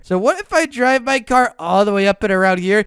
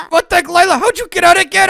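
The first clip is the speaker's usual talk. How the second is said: high, loud laugh into the question; laughing disbelief; 9 semitones higher, 3 dB louder, faster than usual. Real, not acted. Cop seeing Lila escape again, high laughing outburst.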